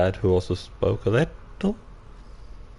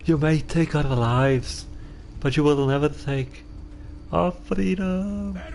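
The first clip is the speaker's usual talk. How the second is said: put-on terrible Scottish accent; 6 semitones higher than usual. Quoting a freedom speech to viewers in a terrible Scottish accent. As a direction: ham up a rousing speech in a poor Scottish accent. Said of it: "That was a terrible Scottish accent".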